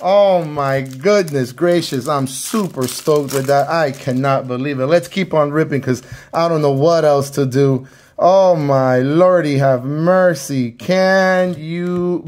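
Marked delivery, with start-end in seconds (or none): none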